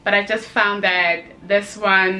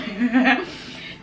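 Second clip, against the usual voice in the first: short laugh; 4 semitones higher than usual. Real, not acted. Laughing off an awkward moment.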